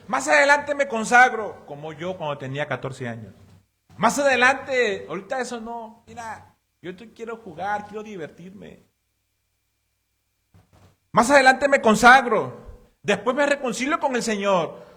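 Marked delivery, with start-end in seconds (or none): 0.1-1.7 proclamado fuerte y alto, en tono burlón; joven despreocupado. Retold or imitated. Imita al joven que aplaza. 4.0-8.8 joven despreocupado. Retold or imitated. Imita al joven que aplaza. 11.1-14.8 "Más adelante me consagro" gritado, alto y muy fuerte; lánzalo como un joven que presume de aplazar; joven despreocupado. Retold or imitated.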